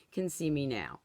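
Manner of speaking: ordinary delivery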